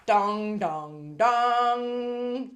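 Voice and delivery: loud sung-out dong dong dong; a loud buzzer-like dong for bad news; mock losing bell. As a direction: ring out the bad-news gong.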